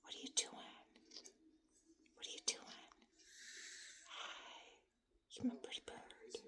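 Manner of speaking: soft unvoiced whisper. Owner whispering lovingly to her parrot. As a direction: whisper sweetly to the bird.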